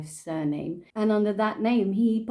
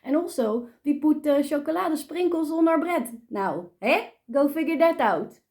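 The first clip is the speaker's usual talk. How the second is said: exaggerated Dutch accent, higher and lively; playful mock Dutch English; 4 semitones higher than usual. Shown as a demonstration. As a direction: ham up a thick Dutch accent. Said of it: "if people made fun of a Dutch accent".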